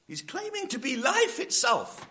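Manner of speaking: normal talking voice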